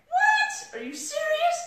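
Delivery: loud, very high squeal of surprise; shocked disbelief. Retold or imitated. Wife reacting to husband's Facebook Live dare, shrieking in disbelief.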